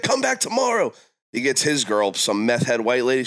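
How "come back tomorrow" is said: much higher, hammed-up drunk invite; Tyrone inviting. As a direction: call it out like a friendly neighbor. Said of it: "He's like".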